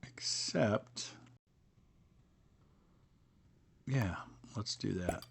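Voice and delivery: hushed whisper to himself. Real, not acted. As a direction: breathe it out under the breath. Muttering a game move to himself.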